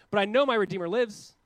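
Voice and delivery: loud, high cry of faith; loud declaration. Retold or imitated. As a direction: proclaim it boldly with a rising end. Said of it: "Job goes".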